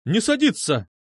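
high, sharp command; angry bark. A narrator's reading. Medusa forbids boys to sit, angry bark. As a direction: bark it angrily, pitched high. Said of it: "зло рявкнула она".

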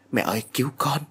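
a mild acted plea to mother-in-law, half-voiced; desperate cry for help. A narrator's reading. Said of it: "Mẹ liền gào lên". (gào lên cầu cứu, giọng hoảng sợ nhẹ)

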